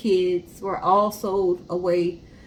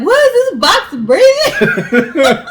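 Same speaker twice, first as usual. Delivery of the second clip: much higher and louder, slow and drawn out, laughing through it; a hammed-up, shocked shout with a laugh; mock startled shout; 10 semitones higher, 14 dB louder, much slower than usual. Playing the postal workers finding a breathing box, laughing mock shout. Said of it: "They'd be like".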